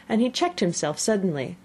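usual speaking voice

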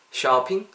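"쇼핑" as "sha-ping", open first vowel; "쇼핑" opened to an American-style "shah". Shown as a demonstration. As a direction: model "쇼핑" with a clear "ah". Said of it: "아야에 가깝게 해서".

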